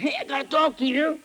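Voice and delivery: high-pitched imitated urgency; eager woman's voice. Woman corners the preacher at camp, imitated eagerness. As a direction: grab his attention in a high voice.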